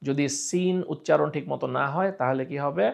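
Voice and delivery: usual speaking voice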